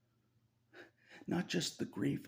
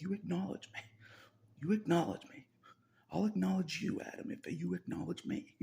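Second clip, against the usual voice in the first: higher, louder mock God demanding to be acknowledged; imitating God demanding; 9 semitones higher, 5 dB louder than usual. Speaker voicing God scolding Adam, a higher, louder mock demand. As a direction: play God insisting, half-joking.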